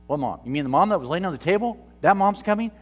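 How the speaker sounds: incredulous questioning. Retold or imitated. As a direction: act out a baffled man double-checking, voice climbing. Acts out Doug's disbelief at news.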